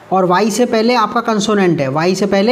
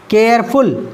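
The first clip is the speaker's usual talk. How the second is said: Careful split into two beats, care and फुल; two syllables; slower than usual. Shown as a demonstration. Teacher counting syllables, careful in two separate beats. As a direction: break careful after care. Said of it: "दो बार में बोला है".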